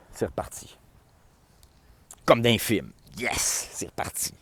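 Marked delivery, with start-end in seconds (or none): 0.1-0.8 whispered. Retold or imitated. Music restarting, quiet relief. 3.2-4.3 whispered. Retold or imitated. Music restarts again.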